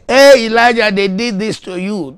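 high, booming imagined call; loud voice. Acted. Preacher acts the voice Elijah wants to hear, loud and high. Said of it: "wants some loud voice to say".

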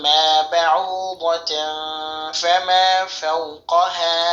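slow, drawn-out chanted recitation; slow Quranic chant. Acted. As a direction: recite it slowly with melodic tajweed. Recites the verse.

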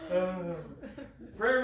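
laughing hard; can't stop laughing; real laughter winding down. Real, not acted. Still laughing after joke.

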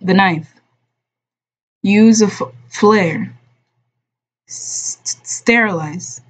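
mild gasping, halting words; lightly voiced wounded man catching his breath; broken by gasps. A narrator's reading. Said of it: "his words broke with airy gasps".

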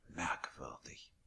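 slow private whisper to himself; whispered thought. A narrator's reading. Billy muttering to himself, whispered and slow. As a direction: breathe the word, puzzled.

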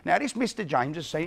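ordinary talk

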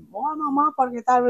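put-on high, thrilled child voice to mum; excited phone call. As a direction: gush like a proud kid on the phone. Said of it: "todo emocionado".

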